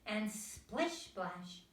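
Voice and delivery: ordinary delivery, nothing marked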